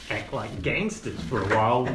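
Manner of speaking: usual speaking voice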